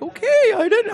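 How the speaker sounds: high, small mock-defensive voice; squeaky backing down after a scolding; meek husband. Husband's meek reply, mimicked.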